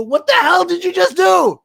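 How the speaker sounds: high, loud furious shout; angry yell. Retold or imitated. Ex-wife calling back, yelling at him in fury. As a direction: hurl it back down the phone in a rage.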